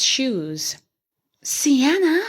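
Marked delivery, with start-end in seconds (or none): 1.4-2.2 a startled gasp, pitch up; shocked gasp on the name. A narrator's reading. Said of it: "he gasped".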